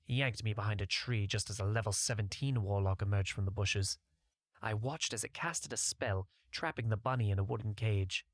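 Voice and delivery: plain reading voice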